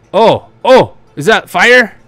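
high, loud startled burst; startled surprise. Real, not acted. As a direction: spot it suddenly, voice jumping up. Startled by fire in game.

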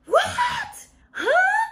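very high, loud shriek of shock; shrieked disbelief. Real, not acted. Reader hit by a plot twist, shrieked disbelief. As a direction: shriek it, utterly baffled.

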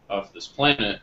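ordinary talk, nothing marked

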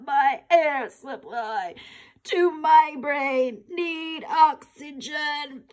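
hammed-up gasping panic, high and stretched out slowly; milk it like you're fainting from a tight tie; dramatic choking. Boy overdramatizing to dad that the tie chokes him. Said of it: "Oh, stop being so dramatic".

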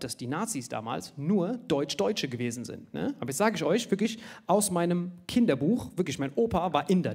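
normal talking voice

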